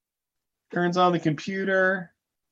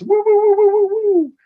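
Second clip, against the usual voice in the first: high, loud "woo woo" siren; imitated police siren; 7 dB louder than usual. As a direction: wail like cop car sirens. Imitates police sirens around them.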